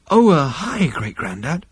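hesitant, sheepish hello to an elder; nervous, breathed greeting. A narrator's reading. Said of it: "Zaphod stuttered nervously for a moment".

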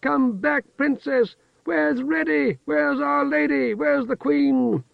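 high, excited calling from the crowd. A narrator's reading. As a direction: call out like a cheering crowd on the shore. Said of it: "The crowd cheered and called more wildly".

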